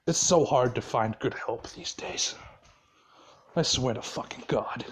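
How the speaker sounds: sigh, then low and quiet; mock-weary anger held down under the breath; retold seething exasperation. Boss fed up with his help, retold seething exasperation. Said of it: "he's just so fucking mad".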